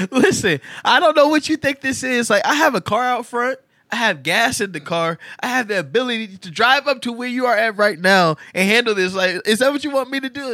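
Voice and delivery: loud, high, laughing through the threats; hammed-up furious father telling her off; angry dad lecture.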